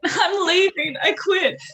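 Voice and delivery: high, laughing quote of quitting; giggling mock exclamation; laughing mock resignation. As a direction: blurt it through laughter.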